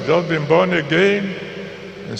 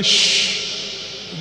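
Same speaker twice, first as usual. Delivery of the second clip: whispered shush; 4 dB quieter than usual. Retold or imitated. Friend hushing him during prophecy.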